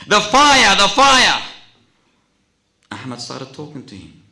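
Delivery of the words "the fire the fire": loud, high cry of alarm. Acted. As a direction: cry out as the burned brother. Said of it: "cries out".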